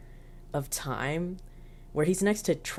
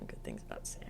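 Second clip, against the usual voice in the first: whispered aside to herself; 16 dB quieter, faster than usual. Shown as a demonstration. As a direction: breathe it out as an aside.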